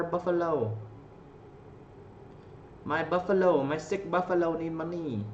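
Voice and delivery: imitating a bar girl sweet-talking a customer; mock bar hostess.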